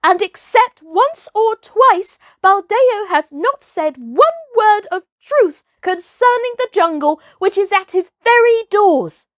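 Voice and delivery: put-on boy calling back defiantly; called back. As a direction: call it back as you leave. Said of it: "he called back over his shoulder".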